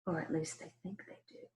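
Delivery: hushed confiding whisper; secret aside. Acted. Wolf letting listeners in on a secret, whispered. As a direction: lean in and whisper it.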